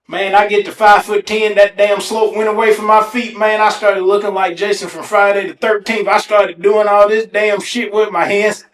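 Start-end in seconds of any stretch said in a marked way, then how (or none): none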